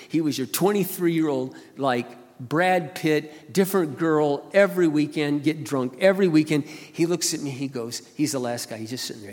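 ordinary talk

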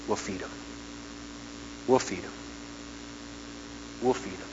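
hushed, repeated vow; quiet promise. Real, not acted.

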